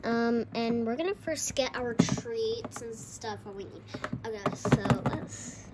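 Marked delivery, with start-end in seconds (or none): none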